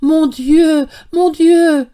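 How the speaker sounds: high, loud overjoyed cries; bewildered happy exclamations; excited old man crying out; flustered old man. A narrator's reading. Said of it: "courir égaré dans la chambre en faisant".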